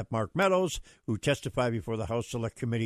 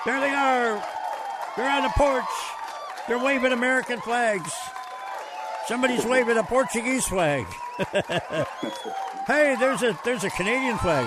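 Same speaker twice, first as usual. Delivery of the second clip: high, loud, gleeful play-by-play of a pretend crowd; excited shouting; 11 semitones higher, 5 dB louder than usual. Host pretending to see flag-waving fans on the porch, excited shout. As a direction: shout it like spotting fans from the stage.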